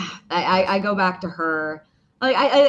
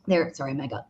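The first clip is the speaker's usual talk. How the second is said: morning voice; 5 semitones lower, 4 dB quieter than usual. Real, not acted. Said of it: "ugh, morning voice".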